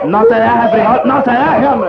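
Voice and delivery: grito alto de barra; ¡no se deje! a todo pulmón; gritando para animar. Acted. Barbero anima gritando en la pelea. (gritando para animar)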